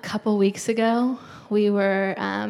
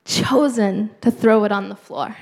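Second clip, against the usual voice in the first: louder, driving sermon push; preaching at full force; 5 dB louder than usual. Acted. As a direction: hammer the point home to the congregation. Preaching peak on Pharisees.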